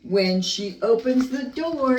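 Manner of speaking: ordinary delivery, nothing marked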